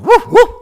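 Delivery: loud, high imitated woofs; high-pitched mock dog bark. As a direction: bark like a dog, two short bursts. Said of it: "The dog going".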